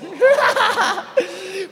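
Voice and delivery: high burst of laughter; loud laugh. Real, not acted. Preacher laughing at his own joke about his year, loud high laugh.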